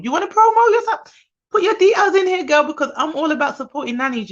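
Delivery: playful tease. Real, not acted. Teasing a commenter on live.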